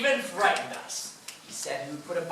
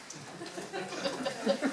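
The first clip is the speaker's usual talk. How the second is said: soft breathy laugh; 7 semitones higher, 11 dB quieter than usual. Acted. Mayor laughs after forgiving them.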